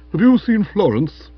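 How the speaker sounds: high, loud, fretful question; anguished. A narrator's reading. Careworn man seeking Florence, anguished. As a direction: ask as a worried man at the door. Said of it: "contorted with anguish".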